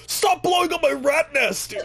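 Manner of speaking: high, slow whiny imitation of friend; mock a friend's high, slow whining complaint; whiny gamer friend. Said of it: "and he'd be like".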